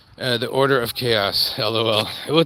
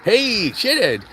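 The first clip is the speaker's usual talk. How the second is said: mock taunting; 6 semitones higher than usual. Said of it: "What are we gonna call you".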